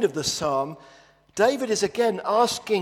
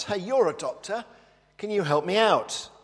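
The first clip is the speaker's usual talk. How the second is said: higher, calling up from the bottom of a hole; mock shout; 6 semitones higher, 5 dB louder than usual. Man in the hole calls to a doctor, mock shout. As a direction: hail the passing doctor, pleading. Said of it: "and the guy shouts".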